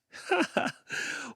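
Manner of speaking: high breathy taunting titter; mocking kid giggle. A narrator's reading. Kid laughs at the girl stuck in the swing, high and mean.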